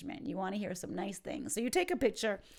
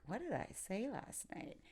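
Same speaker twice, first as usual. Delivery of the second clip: puzzled question; 11 dB quieter than usual. Shown as a demonstration. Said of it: "in a questioning kind of way".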